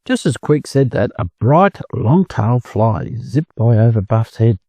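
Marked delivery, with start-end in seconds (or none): none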